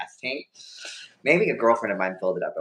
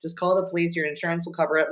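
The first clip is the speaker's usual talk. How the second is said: reassuring neighbor; 7 semitones higher than usual. Retold or imitated. Neighbor calming the teen after the crash, higher and soothing. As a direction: coo at her like a kind stranger calming a kid. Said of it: "she's reassuring me".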